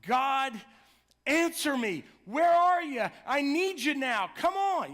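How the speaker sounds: anguished pleading shout; crying out to God. Retold or imitated. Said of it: "you just cry out to God".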